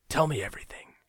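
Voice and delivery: hushed, breathy ask; whispered request. Acted. Aged friend urges narrator to talk, whispered. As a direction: lean in and whisper it.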